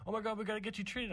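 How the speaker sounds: high, rushed mock panic; freaking-out dad. Dad seeing his hurt kid, imitated freak-out. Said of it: "he's freaking out".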